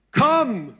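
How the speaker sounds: a booming high "Komm!" across the water; loud call. Acted. Jesus calling Peter onto the water, loud and commanding. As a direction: shout it like a summons.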